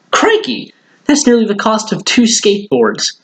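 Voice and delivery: high amazed gasp of a word; surprised boy. Acted. Boy stunned by vet bill, high surprised exclaim. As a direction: sound stunned by the price.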